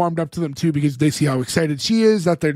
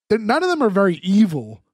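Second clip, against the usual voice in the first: ordinary delivery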